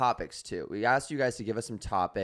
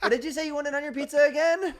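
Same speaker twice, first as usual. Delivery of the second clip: calling from another room; 5 dB louder than usual. Pretend. Girlfriend calling from other room.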